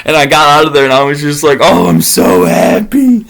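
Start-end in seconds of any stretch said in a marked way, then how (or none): 1.6-3.2 slow, drawn-out relief; slow relieved joy. Retold or imitated. Hiker driving away, slowly relieved. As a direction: breathe out the happiness. Escaping in truck.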